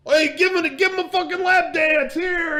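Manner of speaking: high, loud demand called across the club; imitated rowdy guy yelling; rowdy shout. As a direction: holler it like a pushy drunk buddy. Rowdy guy calls out to stripper.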